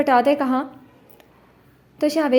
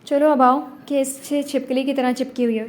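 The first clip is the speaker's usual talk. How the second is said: teeth-gritted, higher and louder irritation; through gritted teeth; 4 semitones higher, 4 dB louder than usual. A narrator's reading. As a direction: bite the words out through clenched teeth. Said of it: "gloves چبا کر کہا".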